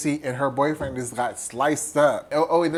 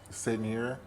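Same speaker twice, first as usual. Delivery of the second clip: deadpan, much softer; couldn't care less, quietly; unbothered; 3 semitones lower, 11 dB quieter than usual. Retold or imitated. Unbothered Cindy on phone.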